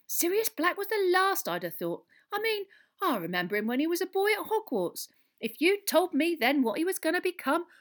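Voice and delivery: high, loud landlady voice; chatty barmaid. A narrator's reading.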